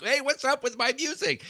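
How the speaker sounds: high-pitched mock yell, no real volume; retold yelling. Husband complaining to wife downstairs about the music, high mock yell. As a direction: imitate yourself calling out in complaint. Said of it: "I'm yelling like".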